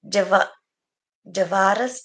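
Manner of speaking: ordinary delivery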